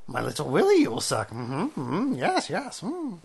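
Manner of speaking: hammed-up alien sage with rising hums; Yoda. Teasing the friend as a crude Yoda, hammed-up creature voice. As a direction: play a lewd little creature, humming upward. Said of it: "Yoda on coke".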